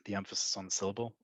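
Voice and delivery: "The emphasis on the syllable" tossed off quick and soft; quick, hushed aside on "The emphasis on the syllable"; joke misplaced stress. Shown as a demonstration. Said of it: "I hope you got that joke".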